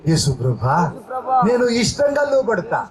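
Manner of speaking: preacher leading prayer aloud, phrase by phrase; fervent prayer. Acted. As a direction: pour it out to God with the church. Leads congregation in prayer. (ప్రార్థనలో ఉత్సాహంగా)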